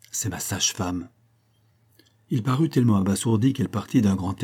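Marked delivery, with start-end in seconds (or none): 0.1-1.1 softer, leaning in to his ear; a lightly voiced murmured secret; confiding aside. A narrator's reading. Lina confides in Maurice's ear, softly. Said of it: "se penchant à son oreille".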